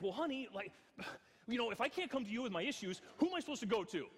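hammed-up husband pleading in frustration; retold angry complaint. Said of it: "And I'm getting angry".

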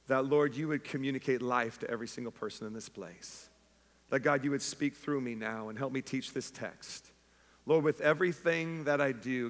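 hushed Easter Sunday prayer; quiet prayer. Acted. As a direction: pray it gently over the room. Leads congregation in prayer.

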